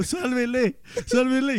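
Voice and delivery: imitating desperate pleading to the vet; mock desperate begging. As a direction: beg the vet, hammed-up. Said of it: "Y yo ya desesperado".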